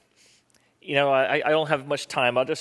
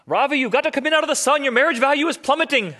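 high, loud mock-mother voice; scolding mum, shrill and loud on the phone; fussing mother; 8 dB louder than usual. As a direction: play his mother, pitched way up and loud. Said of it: "and she's like".

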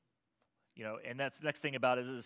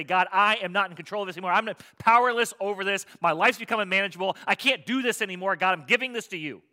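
loud, raised, urgent prayer of surrender; pleading confession; 7 semitones higher, 11 dB louder than usual. Acted. A sinner surrendering to God, loud earnest confession. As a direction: cry out to God, admitting defeat. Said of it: "I gotta be humble enough to say".

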